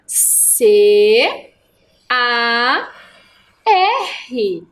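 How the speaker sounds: "C A R" letter by letter, each held and set apart; slow spelling. Shown as a demonstration. Teacher showing pupils how to write car, "C A R" spelled slowly.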